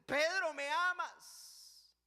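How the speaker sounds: high, loud and very slow question; the Lord asking. Acted. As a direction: stretch the question out, pleading. The Lord asks Pedro again. (el Señor pregunta a Pedro, fuerte y muy lento)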